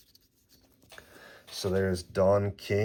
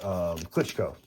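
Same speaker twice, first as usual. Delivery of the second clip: ordinary delivery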